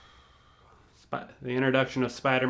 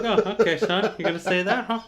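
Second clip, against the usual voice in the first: higher, teasing "oh okay son" in a put-on voice; mock suspicious taunt; 8 semitones higher than usual. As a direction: play him out, lightly mocking. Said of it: "I'm like".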